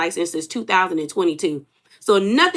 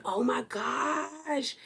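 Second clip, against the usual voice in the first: very soft, drawn-out amazement; hushed disbelief; 2 semitones higher, 13 dB quieter, much slower than usual. Retold or imitated. Vlogger reacting to how Britt sounds, hushed and amazed. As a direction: say it slowly under your breath, stunned. Stunned aside at the resemblance.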